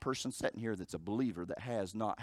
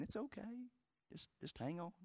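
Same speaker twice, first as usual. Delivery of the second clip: much softer, hushed comforting words; gentle reassurance; 8 semitones higher, 14 dB quieter than usual. Retold or imitated. Jesus comforting the grieving sisters, hushed and gentle. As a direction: soothe a grieving friend. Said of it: "I can see him probably saying".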